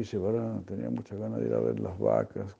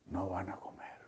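whispered; 2 semitones lower, 12 dB quieter than usual. A narrator's reading. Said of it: "Agachando su cabecita y llorando".

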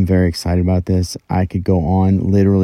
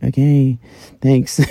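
sarcastic thanks; 6 semitones higher, slower than usual. Retold or imitated. Sarcastic reply to critics.

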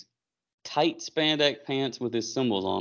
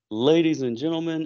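announcer call; 7 semitones higher than usual. Retold or imitated. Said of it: "Paul Heyman's voice comes over the loudspeaker".

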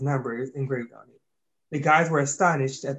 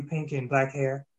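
ordinary delivery

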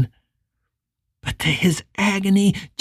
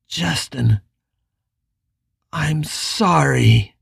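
slow, halting, mildly broken; stammered apology; 3 semitones lower, slower than usual. Acted. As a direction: falter through the apology. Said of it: "Richard stammered".

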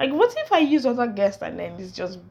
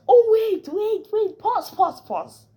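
high, loud call to wait; sudden stop; 8 dB louder, slower than usual. Real, not acted. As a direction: stop everything, you forgot something. Suddenly stops her goodbye.

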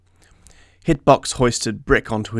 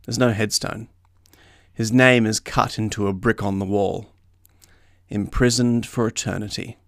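plain reading voice

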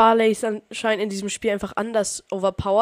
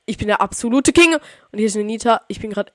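ordinary delivery

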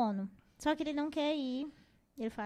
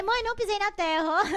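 put-on high, loud kid protesting; lightly voiced protesting child; child's voice; 7 semitones higher, 7 dB louder than usual. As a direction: squeal it like an innocent kid. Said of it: "aí você".

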